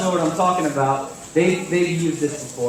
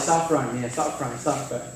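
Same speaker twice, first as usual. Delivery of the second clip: lower, softer casual "Stop front, man"; casual slang; 6 semitones lower, 6 dB quieter than usual. Retold or imitated. Pastor imitating how friends casually say "stop front", softer and lower. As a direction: toss it off like street slang. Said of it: "we say it all the time".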